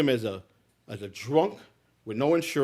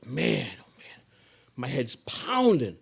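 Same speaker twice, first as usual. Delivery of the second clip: hungover groan; slower than usual. Retold or imitated. Hungover J J wakes up.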